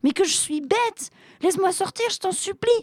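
tiny, very high moaning voice; whining plea. A narrator's reading. Trapped genie begs to be let out, whining. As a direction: beg pitifully, way up high. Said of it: "gémit le génie".

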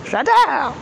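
j'adore sung out high and bright; delighted. Real, not acted. Repeats j'adore with more glee.

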